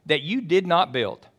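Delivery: high, loud, driving sermon voice; rising pulpit fervor; full-force preaching. Acted. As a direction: preach it out to the whole room. Preacher building to climax.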